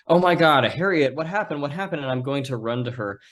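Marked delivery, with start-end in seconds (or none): 0.1-2.0 high, alarmed cry, loud at the start; acted shout. Player calls to hurt Harriet, alarmed shout. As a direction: burst out, then ease off. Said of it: "I'm going to shout".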